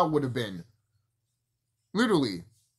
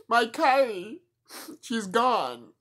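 mock lament; 8 semitones higher, slower than usual. Mock lament over wrestler leaving.

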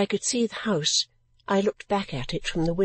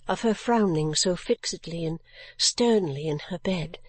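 usual speaking voice

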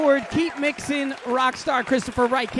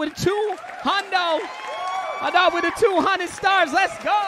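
excited shout; 7 semitones higher than usual. Real, not acted. Hyping a donation on stream.

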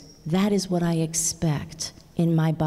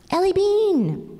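high, louder call across the beach; calling out a name; 13 semitones higher, 4 dB louder, slower than usual. A narrator's reading. Uncle calls his niece's pet name across the beach, high loud call. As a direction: call to her from a distance, glad to see her. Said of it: "call her special name".

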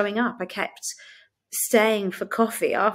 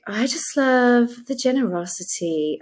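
put-on American accent, higher pitched; mock American lilt, raised in pitch; 4 semitones higher than usual. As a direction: imitate a gushing American churchgoer. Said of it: "why I'm doing this accent".

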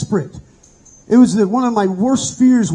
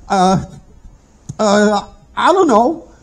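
trembling reply; 2 semitones higher, much slower than usual. Retold or imitated. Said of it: "still trembling".

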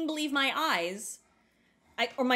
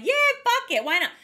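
high, loud carefree yell of yeah, fuck it; Pat impression; 11 semitones higher, 7 dB louder, faster than usual. Friend Pat agreeing to buy the road cake, high and loud. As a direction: throw it out loud and high like a reckless buddy. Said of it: "And Pat was like".

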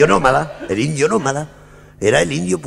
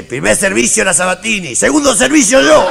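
rising, ever louder umpire announcement; tennis umpire call; 7 semitones higher, 5 dB louder than usual. Retold or imitated. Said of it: "siente que dice". (anuncio de árbitro de tenis, cada vez más fuerte)

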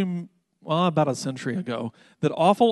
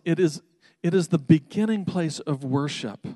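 ordinary delivery

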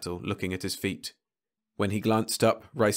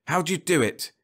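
higher, louder bitter question; bitter demand; 9 semitones higher, 4 dB louder than usual. Acted. Rice confronting the living Leffler, bitter demand. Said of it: "he demanded bitterly".

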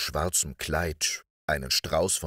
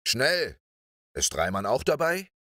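urgent, higher-pitched teacher's call; high brisk command, then a raised question; 6 semitones higher than usual. A narrator's reading. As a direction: call out to hurry and check on the group. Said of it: "rief er".

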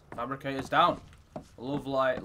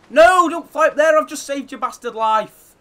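loud, high urgent yell; 7 semitones higher, 7 dB louder than usual. Real, not acted. Player yelling at his Pteranodon to stop fighting. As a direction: shout it at the pet to stop.

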